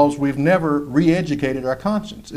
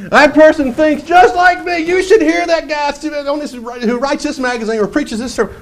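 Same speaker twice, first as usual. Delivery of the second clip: mocking imitation; 11 semitones higher than usual. Preacher mocking a deceived believer, higher and gushing. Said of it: "I'm thinking, "Oh boy, here's a deceived person".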